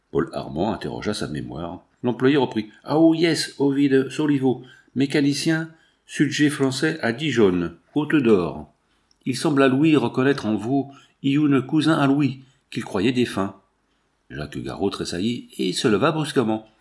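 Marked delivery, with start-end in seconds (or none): 2.8-13.5 put-on French with a heavy English accent; formal steward asking in English-accented French. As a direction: foreign employee's English-tinged French.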